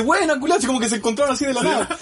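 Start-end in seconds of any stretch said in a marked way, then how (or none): 0.0-0.6 mock greeting. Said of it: "se le dice".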